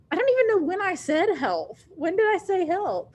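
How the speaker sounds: exasperated disbelief. Real, not acted. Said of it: "teacher protests teasing".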